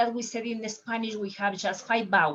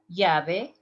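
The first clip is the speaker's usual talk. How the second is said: Central American llave with a y, "yabe"; 2 semitones lower, 3 dB louder than usual. Shown as a demonstration. Teacher demos Central American key. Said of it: "in Central America".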